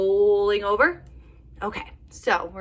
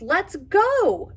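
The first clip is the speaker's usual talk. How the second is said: shouting; 11 semitones higher, 6 dB louder than usual. Acted. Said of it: "Badger lights a lamp and he shouts".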